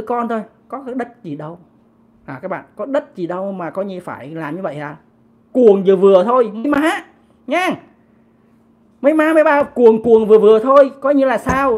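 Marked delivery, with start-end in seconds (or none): 5.5-11.8 loud telling-off of fans; mock scolding. Commentator telling obsessed fans to tone it down, loud scolding. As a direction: scold them loudly, half in exasperation.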